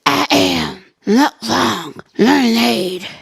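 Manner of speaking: slow, low storybook mermaid; low mermaid character voice. Acted. Mermaid introducing herself to viewers. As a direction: announce yourself slowly, deep.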